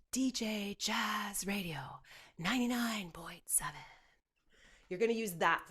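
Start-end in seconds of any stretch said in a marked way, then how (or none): 0.1-3.7 whispery DJ voice. Retold or imitated. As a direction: breathe it out like a smooth jazz DJ. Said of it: "imagine you're a D J artist".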